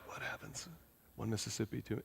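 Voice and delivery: breathless whisper, counting the seconds; hushed nervous countdown. Retold or imitated. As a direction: whisper it like you're waiting nervously. Nervous inner count holding hand.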